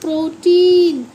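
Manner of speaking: put-on प्रोटीन slow and loud as pro-TEEN; emphatic pro-TEEN for प्रोटीन; demonstrating pronunciation. Shown as a demonstration. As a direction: repeat प्रोटीन with a drawn ee. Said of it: "क्या बोलते हैं".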